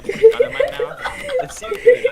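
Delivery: high and loud through laughter; can barely speak for laughing. Real, not acted. Laughing hard.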